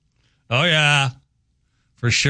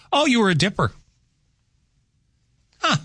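ordinary talk, nothing marked

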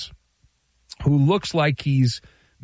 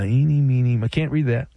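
ordinary talk

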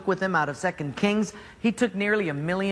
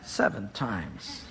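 low, soft, slow grumble; 11 semitones lower, 7 dB quieter, much slower than usual. Acted. Naaman repeating the order, low slow grumble. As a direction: drag it out with disgust.

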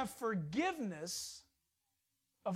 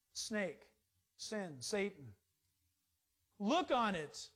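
normal talking voice

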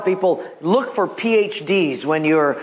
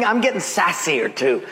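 ordinary delivery

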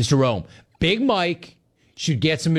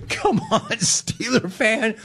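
teasing; 7 semitones higher than usual. Real, not acted. Teasing Steeler fans.

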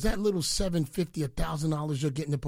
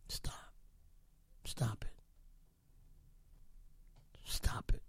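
teasing whisper; 7 semitones lower, 13 dB quieter than usual. Real, not acted. Teasing the rival.

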